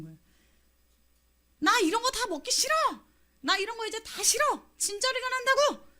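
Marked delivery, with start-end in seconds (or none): 1.6-5.9 high, petulant grumbling of fed-up people; a fed-up crowd whining to God, high and petulant; whiny complaint. Acted. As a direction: whine like you're sick of it all. Grumbling Israelites quoted.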